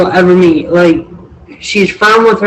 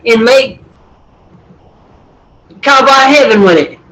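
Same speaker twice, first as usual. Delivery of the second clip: Southern accent; 4 semitones higher than usual. Shown as a demonstration. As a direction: ramble in an exaggerated Southern accent. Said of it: "my southern accent when I do it".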